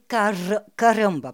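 CARAMBA with a rolled tongue-tip R; rolled front R. Shown as a demonstration. Showing a listener the Brazilian front R, rolled in CARAMBA. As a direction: trill the R in CARAMBA, loudly. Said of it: "on a un R derrière et un R devant".

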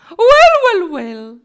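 very high loud first word, then easing; booming Simon. A narrator's reading. Simon grabbing attention with a loud well. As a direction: hit the first well hard.

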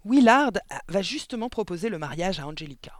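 outburst, loudest at the start; wife's exclamation, easing as it goes; exclaiming. A narrator's reading. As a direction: protest the interrupted TV serial. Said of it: "s'écria madame Verdebois".

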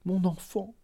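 first of two rising calls of mon enfant; stammering plea. A narrator's reading. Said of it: "Elle balbutia".

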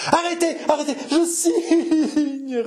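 very high, desperate wail; agonised surrender. Acted. Martyr giving in and agreeing to sign, agonised cry. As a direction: cry out to stop him, trailing into a wail. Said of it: "râla la martyre".

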